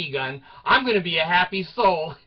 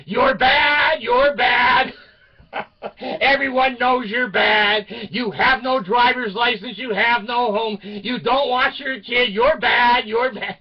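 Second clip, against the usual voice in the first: loud, high shouted taunting at the neighbor; screaming taunts; 6 semitones higher, 8 dB louder, slower than usual. Retold or imitated. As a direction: yell it across the street to shame her. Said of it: "I start screaming".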